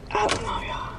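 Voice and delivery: whispered aside. Real, not acted. Trails into whisper.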